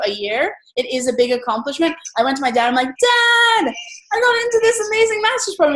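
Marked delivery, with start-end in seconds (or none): 2.9-5.7 excited. Retold or imitated. Telling dad her big news.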